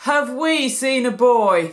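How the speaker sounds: a cheerful priest teasing; grinning priest. A narrator's reading. The priest echoes Joseph's question, amused. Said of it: "the priest grinned".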